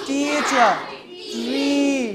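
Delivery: long, held ee in "Teacher" and "Tree"; "Teacher" and "Tree" with lengthened vowels; drawn-out vowel. Shown as a demonstration. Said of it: "এইগুলো একটু লম্বা করে".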